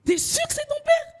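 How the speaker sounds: squeaky high cricket voice. Acted. Cricket mocking the girl about her dad, squeaky high. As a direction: pitch way up for a tiny cricket. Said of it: "le criquet s'arrête pour me dire".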